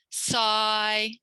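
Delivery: "Sigh" held long on a level note; teacher's sustained "Sigh"; model syllable. Shown as a demonstration. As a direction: draw out "Sigh" clearly for copying. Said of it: "I'm gonna say it and you imitate me".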